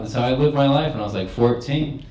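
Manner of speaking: ordinary talk, nothing marked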